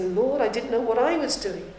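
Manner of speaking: confessing to the Lord, building to a loud cry; a sinner confessing before the Lord, swelling to a loud plea; pleading confession. Acted. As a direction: pour out the guilt, rising to a full-voiced plea. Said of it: "come to fall before him and say".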